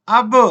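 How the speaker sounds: अब with a bouncing echo on the ब; echoed qalqalah. Shown as a demonstration. Teacher contrasting letters for students, echoed qalqalah on अब. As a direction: release the ब of अब into a tiny extra vowel. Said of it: "इसको बोलते हैं कि आवाज को हरकत".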